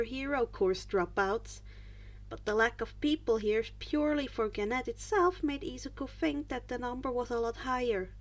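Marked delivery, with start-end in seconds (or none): none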